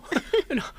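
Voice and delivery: high burst of happy laughter; delighted laugh. Acted. Husband learning his wife is pregnant, laughing with joy.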